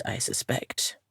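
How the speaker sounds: muttered aside. Acted. Aside muttered under the breath.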